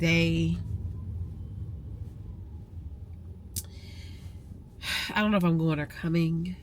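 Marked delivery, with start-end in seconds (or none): 0.6-5.1 long heavy sigh. Real, not acted. Emotional morning.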